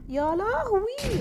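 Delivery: high, slow frightened wail; drawn-out gasp of fear. Real, not acted. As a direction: moan it out in fright. Monster appears.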